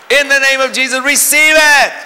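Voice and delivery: high, loud ringing command. Retold or imitated. Preacher laying hands on woman, loud ringing command. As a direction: cry it out over her, full force.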